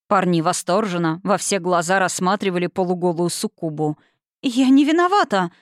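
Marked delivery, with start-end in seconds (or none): 4.4-5.5 higher, louder protest of innocence; flustered protest. A narrator's reading. The succubus grabs her dress, protesting in a fluster. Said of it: "опомнилась та".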